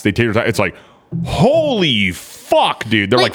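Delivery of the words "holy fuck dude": high, long-drawn astonished exclamation; mock amazement. Man reacting to a video, mock amazement. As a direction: stretch it out in stunned awe. Acted reaction to a startling sight.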